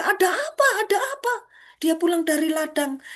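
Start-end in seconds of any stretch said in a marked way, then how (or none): 0.0-1.3 high, anxious repeated question; startled question. Acted. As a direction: ask it twice, rising in alarm. Elder son asks what's happening.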